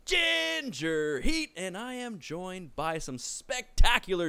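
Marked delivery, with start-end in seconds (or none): 0.0-1.5 higher-pitched, showy announcer delivery; podcast announcer intro. Acted. Host welcoming listeners to the wrestling podcast, announcer intro. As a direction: roll out the show's welcome like a ring announcer.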